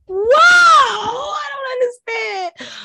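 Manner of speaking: high drawn-out comic cry; mock wail. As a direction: wail it out in fake despair. Mock wail over edges.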